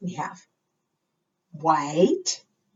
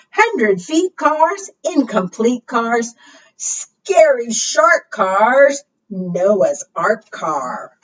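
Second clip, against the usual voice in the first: rhyming read-aloud; 3 semitones higher, 5 dB louder, much slower than usual. Acted. Reading rhyming car book aloud.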